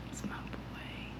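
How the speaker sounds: barely audible whisper, slow. Real, not acted. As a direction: whisper it proudly over him. Proud of sleeping dog.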